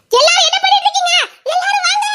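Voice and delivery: high, shouted summons; urgent high yelling; panicked call for help. Acted. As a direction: shout everyone over in panic. Calls everyone over in panic.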